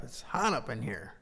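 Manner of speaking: usual speaking voice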